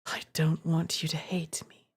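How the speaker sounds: a broken man clinging on; pleading whisper. Acted. Voices Zor pleading, crying.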